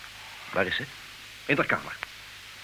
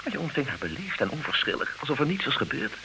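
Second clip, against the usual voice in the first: usual speaking voice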